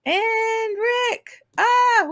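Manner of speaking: excited greeting. Real, not acted. Host spotting Rick joining the stream, excited greeting. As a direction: sing out the name in high delight. Hailing a viewer just spotted.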